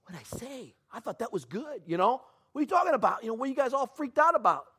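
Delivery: innocently bewildered; confused protest. Retold or imitated. Young man to the shocked pastor, baffled protest. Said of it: "I was like".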